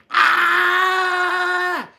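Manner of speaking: put-on high, loud girl's scream. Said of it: "And she screamed".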